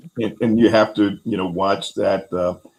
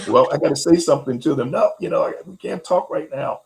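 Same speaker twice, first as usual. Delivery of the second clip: higher-pitched mock harried reply; impulsive brush-off; 8 semitones higher, 3 dB louder, faster than usual. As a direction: imitate a flustered parent putting someone off. Acting out her impulsive first reply.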